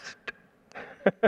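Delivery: short unvoiced st hiss; quick hushed tongue-tip hiss; brother's old signal across the room; whispered st. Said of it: "if I hear a".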